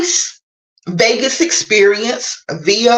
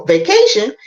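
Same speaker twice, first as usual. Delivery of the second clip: ordinary talk, nothing marked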